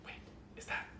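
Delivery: whispering to himself. Acted. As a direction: mutter it under the breath. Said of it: "keeps whispering to himself".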